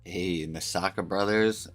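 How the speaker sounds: ordinary talk, nothing marked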